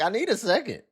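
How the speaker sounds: high, mocking whiny voice; mocking imitation. Cohost mocking the guest's stalling, high whiny imitation. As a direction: mimic her stalling excuse. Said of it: "she's like".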